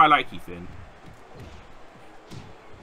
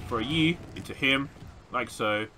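usual speaking voice

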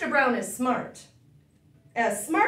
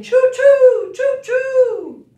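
high, loud choo-choo calls; train whistle; 12 semitones higher, 14 dB louder, much slower than usual. Pretend. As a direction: blast it like a steam train. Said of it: "like a train".

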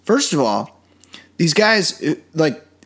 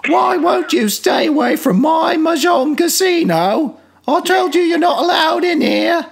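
much higher, loud shrieking comic Brit; Eric Idle; 4 dB louder than usual. Retold or imitated. Mocking the dub that sounds like Eric Idle, shouting high. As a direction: shout it like a Monty Python housewife. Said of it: "sounded like Eric Idle".

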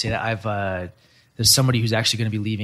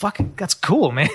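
higher, excited mock delight; retold excited reaction; 7 semitones higher, slower than usual. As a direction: act impressed, voice jumping up. Retelling his reply to departing dev.

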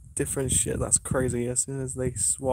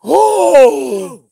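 shocked gasp; 14 semitones higher, 18 dB louder than usual. Real, not acted. Spirit box answers, sudden shock.

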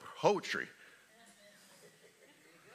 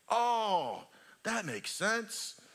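ordinary delivery

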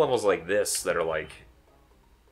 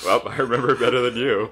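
louder, said through laughter; laughing; 4 semitones higher, 6 dB louder than usual. Real, not acted. Friend teased about a fake fairy tale, laughing as he answers. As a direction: laugh while talking. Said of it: "This doesn't fucking ring any bells".

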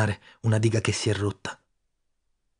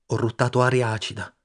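ordinary delivery

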